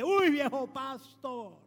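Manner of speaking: imitating a street guy's slow call; street windshield washer. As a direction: hail the pastor slowly, stretching the words. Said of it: "de los que limpia vidrio".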